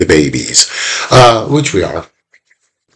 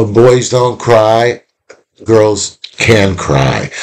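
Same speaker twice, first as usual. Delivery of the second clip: very slow, each word weighed out; drawn-out slogan, boys don't cry; slow emphatic maxim; much slower than usual. Retold or imitated. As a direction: spell out the rule slowly like a lesson.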